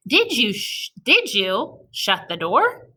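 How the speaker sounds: "Did you shut the door?" read slowly as a real question; reading with expression. Shown as a demonstration. Teacher reading with the class, slowly with expression. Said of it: "reading it with expression".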